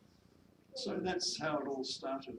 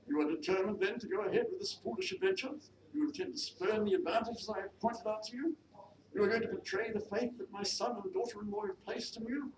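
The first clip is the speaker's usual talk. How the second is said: high, mounting accusatory questions; affronted mistress; 8 semitones higher, 4 dB louder than usual. Acted. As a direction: challenge the maid as her offended employer. Mistress makes final appeal.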